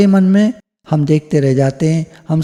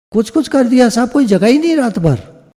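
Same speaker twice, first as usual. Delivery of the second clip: cheeky thief; 8 semitones higher than usual. Retold or imitated. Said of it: "बोला".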